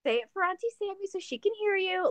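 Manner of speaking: high singsong-like mock parent; pretend coaxing mom. Mom filming kid for auntie, playfully coaxing. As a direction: coax a little one, pitched well up. Voicing herself coaxing a child.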